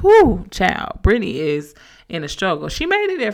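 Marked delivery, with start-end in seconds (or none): 0.0-1.0 exclaiming. Real, not acted. Reacting to Brittany's exhaustion.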